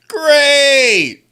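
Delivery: loud, high drawn-out cry; exasperated yell. Real, not acted. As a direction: wail it out in mock despair. Same word repeated, now yelled.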